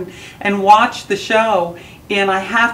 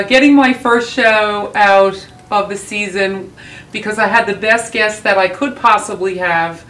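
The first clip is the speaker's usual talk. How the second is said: ordinary delivery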